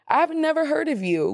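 high, mock-surprised gasp of a friend; a hint of fake surprise; mock shocked friend. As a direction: play the tactless friend faking shock. Quoting the friend.

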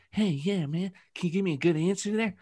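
high, soft, fast lisping boxer voice; terrible Mike Tyson. Retold or imitated. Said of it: "That was a terrible Tyson".

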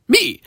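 "Me" alone, pitched very high; a high, insistent "Me"; single out "Me" against everyone else; emphatic me. Acted. Contrasts me with heirs and friends.

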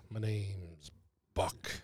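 low, slow, soft movie villain voice; mock sleazy Buck drawl; Buck impression. Quoting Kill Bill character line.

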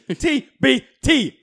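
T, B, T slowly, letter by letter, pitched high; emphatic spelling out. Real, not acted. Co-host insisting on what the hashtag means, slow emphatic spelling.

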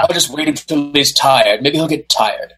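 lightly put-on British accent. Joking about Chuck Norris waiting his turn, mock British accent. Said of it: "a British accent".

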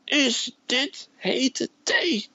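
very high, very slow drawn-out scream; screaming sergeant. Retold or imitated. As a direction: scream it right in his face. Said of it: "En schreeuwt keihard".

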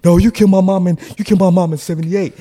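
high, loud insistent accusation; accusing shout. Retold or imitated. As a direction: shout him down.

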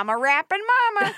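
high, hammed-up rescuer's cry; mock heroic announcement. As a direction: announce yourself as the comic hero, pitched high. Playing the friend rushing to rescue.

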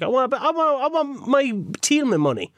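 high, whining demand; whiny demand. Retold or imitated. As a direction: whine it like a spoilt child. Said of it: "And I'm like".

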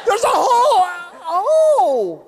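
high, drawn-out mock scream; panicked driver. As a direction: wail in hammed-up panic behind the wheel. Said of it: "Who is driving going".

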